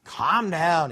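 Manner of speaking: low, dry hushing of a panicker; unimpressed, calming put-down; curt reassurance. Acted. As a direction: drop the pitch and settle him down. God settles the panicking Gideon.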